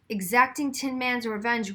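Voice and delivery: plain reading voice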